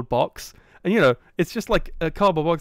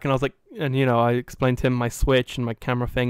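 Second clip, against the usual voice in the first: usual speaking voice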